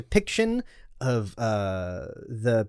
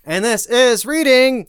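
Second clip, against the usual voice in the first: slow, high, loud announcement; slow booming show title; grand announcer; 7 semitones higher, 5 dB louder, slower than usual. Pretend. Mock show intro.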